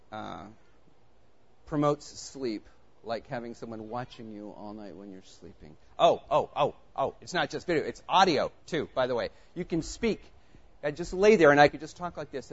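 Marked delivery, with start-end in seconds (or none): none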